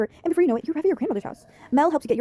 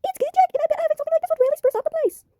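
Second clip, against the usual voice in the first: much higher, sweet coaxing voice; high character voice. Acted. Peyton urging Jack to add cocktails, in a much higher voice. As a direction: coax him like a bright hostess.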